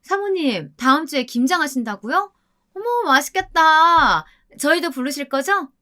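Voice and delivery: high-pitched gushing to flatter a superior; gushy exclaiming, pitched well up; fussy, gushing excitement. A narrator's reading. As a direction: make a great fuss in a high voice. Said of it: "호들갑을 떨며 큰 소리로 이야기를 하더라고요".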